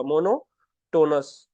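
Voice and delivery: Monotonous stretched out syllable by syllable; slow, drawn-out word. Shown as a demonstration. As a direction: draw out monotonous slowly for the class. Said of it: "teacher spells out word". (शब्द को धीरे-धीरे खींचकर बोलना)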